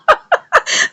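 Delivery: airy high-pitched laugh; a breathy giggle at the drama. Real, not acted. Narrator laughing at the drama, airy.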